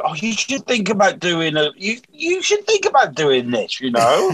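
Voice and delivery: lightly mimicked, high-pitched praise; mock the well-meaning punter; imitating audience member. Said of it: "I still".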